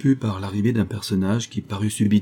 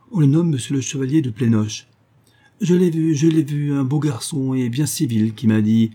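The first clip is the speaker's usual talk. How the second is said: excited; 5 semitones higher, 4 dB louder than usual. Acted. Mother recognizes the suitor.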